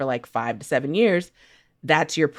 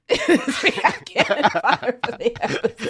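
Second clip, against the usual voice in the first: higher and slower, laughing through it; 8 semitones higher, slower than usual. Real, not acted. Co-host cracking up at the banter, laughing through it. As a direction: let the laugh carry the words.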